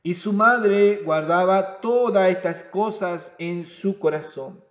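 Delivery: solemn scripture reading. Acted. Reads scripture aloud.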